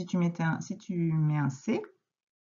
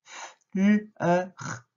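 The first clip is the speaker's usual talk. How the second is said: each sound spaced and loud; robot segmenting; 3 dB louder, slower than usual. Shown as a demonstration. Teacher models robot-style sound splitting for a pupil. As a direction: chop "F, L, E, R" like a robot. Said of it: "Tu peux faire le robot".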